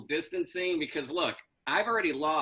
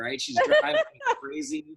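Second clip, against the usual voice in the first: exasperated; 5 dB louder than usual. Acted. Exasperated about mom.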